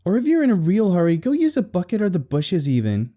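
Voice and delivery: high, quick sarcastic suggestion; high-pitched sibling irritation; irritated sister. A narrator's reading. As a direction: brush off your brother snippily. Irritated sister dismissing her brother.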